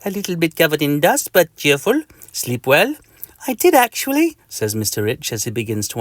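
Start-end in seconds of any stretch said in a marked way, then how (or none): 0.0-3.0 put-on higher meepy insect with French accent; chatty, meepy French mosquito; Mister Mosquito. Mosquito makes small talk, meepy French voice. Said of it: "in a meepy voice". 3.5-4.4 happy, very high squeak; Mister Itch. Acted. Mister Itch answers the mosquito, high and happy. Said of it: "in a happy voice".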